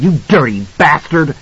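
high, furious woman's voice; outraged cry. A narrator's reading. Wife curses husband who hit son, high angry cry. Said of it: "Wendy cried".

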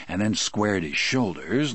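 ordinary delivery

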